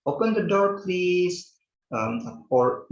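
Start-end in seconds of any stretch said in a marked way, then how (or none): 0.0-1.5 high, gentle polite ask; polite teacher demo; wiser polite request. Shown as a demonstration. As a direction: request nicely with a lilt. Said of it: "lebih bijaksana kalau misalnya kalian mengatakan".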